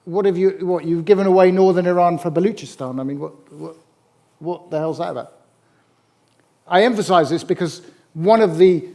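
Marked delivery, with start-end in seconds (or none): 0.0-5.3 mock outraged spluttering. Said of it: "It said".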